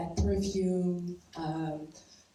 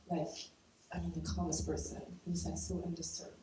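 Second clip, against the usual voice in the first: ordinary talk, nothing marked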